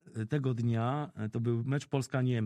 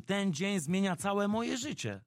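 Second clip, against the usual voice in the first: ordinary delivery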